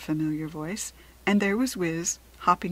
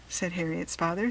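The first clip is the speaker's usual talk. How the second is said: ordinary delivery, nothing marked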